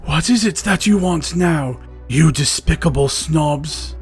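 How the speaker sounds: loud, slow irritated growl; angry. Acted. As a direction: headmaster snapping at the pupils. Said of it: "he asked angrily".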